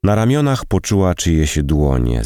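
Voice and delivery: ordinary delivery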